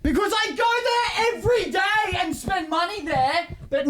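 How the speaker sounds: high, slow mock indignation; indignant. Telling the cafe story, high slow indignant aside. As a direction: stretch it out, outraged.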